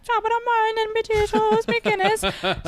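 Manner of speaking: very high, hammed-up fake Irish; mock a bad American go at an Irish accent; butchered Irish accent. Comedian mocking Americans' Irish accents, butchered Irish. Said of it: "Irish accents that you guys were doing".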